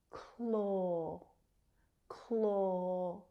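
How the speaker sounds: "Claw" held long on a rounded AW; vowel as in door. Shown as a demonstration. Teacher isolating the vowel for learners, "Claw" with long AW. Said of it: "same vowel sound as in door".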